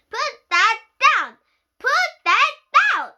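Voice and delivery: very high, loud, slow breathless order; out of breath, yelling the order; panting shout. Acted. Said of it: "my mom said, panting".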